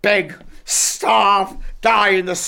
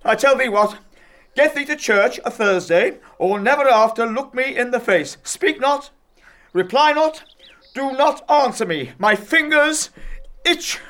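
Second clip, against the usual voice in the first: raised, high-pitched fury; angry, climbing higher towards the end; enraged threat; 4 semitones higher than usual. Acted. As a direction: lay down the law in mounting rage. Father threatens disobedient daughter.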